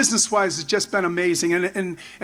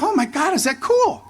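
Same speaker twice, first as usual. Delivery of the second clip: much higher, breathless delight; starstruck thrill; 8 semitones higher than usual. Retold or imitated. Getting a phone call from McMahon, thrilled. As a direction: squeal of disbelief at the call. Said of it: "I'm like".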